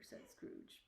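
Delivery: usual speaking voice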